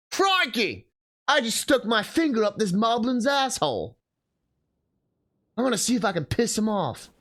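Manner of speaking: hammy Aussie croc-hunter energy; Australian wildlife presenter. Pretend. Aussie wildlife-presenter bit with moblins.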